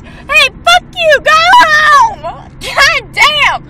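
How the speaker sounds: rowdy shouting at people outside; yelling out. Real, not acted. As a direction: yell at strangers from the car.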